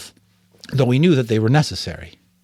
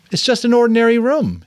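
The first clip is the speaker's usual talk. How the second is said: high, louder surprised remark; pitched up in disbelief; 13 semitones higher, 5 dB louder than usual. Acted. Jonas surprised at plain room.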